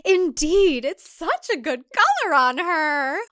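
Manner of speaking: lifted, slow entranced lady; sing-song brainwashed woman; high dreamy spellbound voice. Acted.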